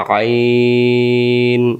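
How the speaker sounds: আইন drawn out slowly, pressed from mid-throat; a long, strained আইন; throat-pressed ain. Shown as a demonstration. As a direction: squeeze আইন from the middle of the throat, long and slow. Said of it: "হলকের মধ্য থেকে একটু চেপে পড়তে হয়".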